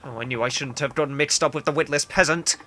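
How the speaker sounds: normal talking voice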